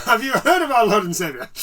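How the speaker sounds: laughing, loud evangelist line; hammed-up door-knocker repeat; mock preacher echo. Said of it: "like you're Mormons and going".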